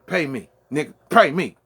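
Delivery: loud demand, the second one shouted. Real, not acted. A fighter demanding his money be paid, shouting.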